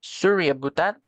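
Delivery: exaggerated Canadian "sorry about that"; Canadian accent. Shown as a demonstration. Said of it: "Canadian way".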